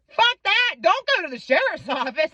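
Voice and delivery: high retold outburst from a friend; indignant warning. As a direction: imitate an emphatic, protective refusal. Friend Guy's urgent warning retold.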